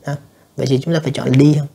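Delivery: ordinary talk, nothing marked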